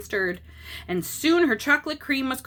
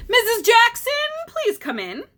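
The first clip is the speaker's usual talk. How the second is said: much higher and louder call; 13 semitones higher, 8 dB louder than usual. Acted. Doctor calling the mother in from the waiting room, loud. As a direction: call her name across the waiting room.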